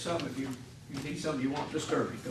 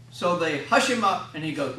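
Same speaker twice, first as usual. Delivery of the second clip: ordinary talk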